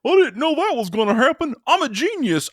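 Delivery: high, far voice as a smug know-it-all Peter; mock Peter's boast in a high put-on voice; high smug nerd voice brushing it off. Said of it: "freaking Peter's like".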